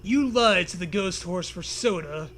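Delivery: ordinary talk, nothing marked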